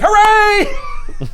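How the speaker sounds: high, loud cheer. Retold or imitated. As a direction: throw both arms up and cheer. Cheering a happy ending.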